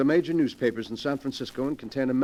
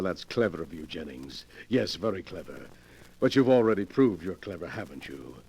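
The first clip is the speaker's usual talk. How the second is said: usual speaking voice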